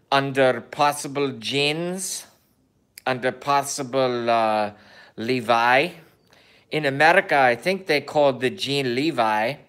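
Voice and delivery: a light mock Kazakh Borat accent in broken English. Vlogger joking to viewers in a light mock Borat accent. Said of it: "accent of Borat".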